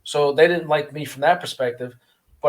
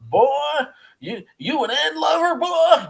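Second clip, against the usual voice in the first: much higher-pitched mock Western Virginia drawl; heavy Western Virginia accent; 13 semitones higher than usual. Officer with a mouthful of dip insulting a prisoner, mock Western Virginia accent. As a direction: imitate an officer's thick Western Virginia twang. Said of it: "Western Virginia accent".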